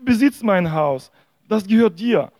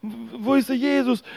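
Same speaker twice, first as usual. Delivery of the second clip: mock cry for help; 6 semitones higher than usual. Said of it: "und er schreit".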